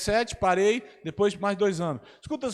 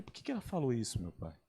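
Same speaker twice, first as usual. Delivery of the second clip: much softer, lower, almost to himself; hushed wondering; 5 semitones lower, 10 dB quieter than usual. Retold or imitated. Pastor puzzling over the insult, soft and low. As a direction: murmur the question inwardly to God. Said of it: "eu falei".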